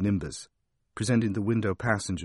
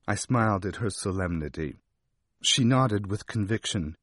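plain reading voice

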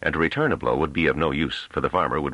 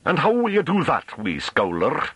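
high-pitched mocking question; taunting rustic farmer; 13 semitones higher than usual. A narrator's reading. Farmer daring the little scholar to leave, taunting. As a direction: needle him in country dialect.

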